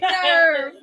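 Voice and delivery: high, loud, wailed word; hammed-up aggrieved wail; mock outrage. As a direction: cry it out in playful indignation. Repeats acted outrage.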